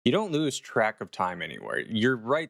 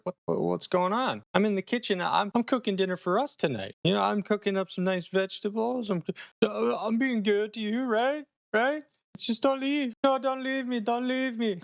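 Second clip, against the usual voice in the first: pleading; 11 semitones higher than usual. Retold or imitated. Husband begging wife not to leave.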